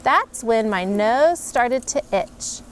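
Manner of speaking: plain reading voice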